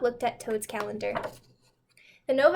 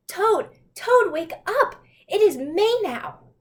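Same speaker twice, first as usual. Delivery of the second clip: high, loud, slowing on May now; excited wake-up call; 8 semitones higher, 7 dB louder, slower than usual. Acted. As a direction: burst in with the good news. Frog waking Toad again.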